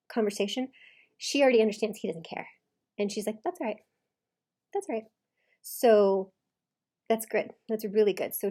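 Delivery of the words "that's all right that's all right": higher, unbothered reply; boring response. Retold or imitated. Coach voicing wife gray rocking husband, bland unbothered reply. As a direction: give a bland little brush-off, pitched up. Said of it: "she's giving him a boring response".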